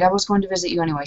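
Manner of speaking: rushed, fast, in one breath; said really quickly. Acted. Character quickly reassuring her friend, words tumbling out fast. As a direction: hurry the words out before anyone can argue. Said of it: "she says really quickly".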